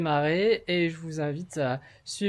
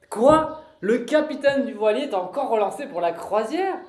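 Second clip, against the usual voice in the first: loving mother on the phone, fairly loud; acted phone call; 3 semitones higher, 5 dB louder than usual. Actor playing a mother calling her lover in rehearsal. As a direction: play the fond mother chatting on the phone.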